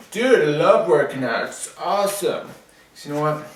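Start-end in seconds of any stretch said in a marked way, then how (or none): 0.1-2.5 mock-enthusiastic gym bro, louder and higher. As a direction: ham up a fitness fanatic gushing. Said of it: "everyone who's like".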